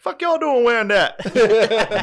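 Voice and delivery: very high, loud, slow and laughing; reenactor voice. Retold or imitated. Imagining the reenactor scolding them, high and laughing. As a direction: bark it out in a hammed-up high voice. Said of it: "he would've been like".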